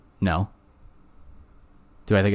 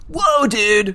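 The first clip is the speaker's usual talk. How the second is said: high, loud surfer-dude whoa; Ninja Turtles voice; 14 semitones higher, 7 dB louder than usual. Acted. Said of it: "You just talk like Ninja Turtles the whole time".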